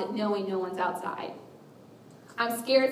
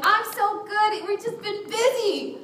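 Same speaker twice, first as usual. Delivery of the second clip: high, drawn-out phony brightness; fake cheerful; 11 semitones higher, slower than usual. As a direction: overdo being absolutely fine. Fake cheerful answer masking hurt.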